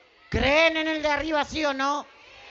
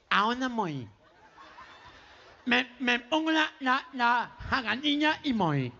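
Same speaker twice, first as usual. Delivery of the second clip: put-on nasal boy stumbling over blurred consonants; gangoso, nasal twang. Boy asks mother where to go, gangoso nasal voice. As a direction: talk through the nose, muffled and stammering. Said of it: "que era gangoso".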